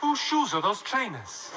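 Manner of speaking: slow, puzzled Welsh lilt; confused Welsh accent. Shown as a demonstration. Comedian teaching the crowd Welsh, confused Welsh accent. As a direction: drag it out in baffled Welsh. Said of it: "you've just gotta sound confused".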